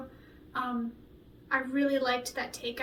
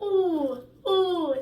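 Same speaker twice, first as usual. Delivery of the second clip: loud thrilled coos; delighted by her own take; excited; 5 semitones higher, 6 dB louder than usual. Real, not acted. Singer thrilled after nailing the take, loud cooing.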